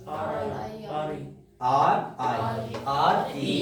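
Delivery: "आर आई" drawn out very slowly; slow spelling. Shown as a demonstration. Teacher spelling the sound slowly for pupils. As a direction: spell each letter slowly. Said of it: "तो आर आई सी बनी है".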